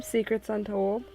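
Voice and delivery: ordinary talk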